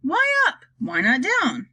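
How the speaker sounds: high-pitched first cry, then easing; mild storybook shout, starting high; shouted protest. A narrator's reading. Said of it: "they all shouted at once".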